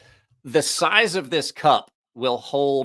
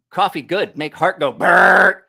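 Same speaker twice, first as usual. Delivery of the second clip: loud, broken caveman speech; 5 semitones higher, 10 dB louder, slower than usual. Pretend. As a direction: grunt it like a caveman. Caveman talk about coffee.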